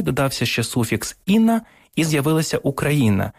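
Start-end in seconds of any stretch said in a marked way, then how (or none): 1.3-1.6 «іна» said high and loud, set apart as a suffix; suffix stressed. Shown as a demonstration. Explaining a word's parts to the host, high emphatic suffix. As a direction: lift «іна» sharply to show the suffix. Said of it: "«украй»".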